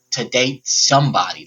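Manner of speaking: usual speaking voice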